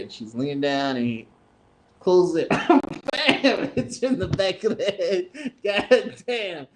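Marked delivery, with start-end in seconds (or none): 2.5-6.7 high, cracking up while telling it; real laughter breaking into the words; laughing. Real, not acted. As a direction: struggle through laughter at your own punchline. Laughs retelling hatch hitting grandma.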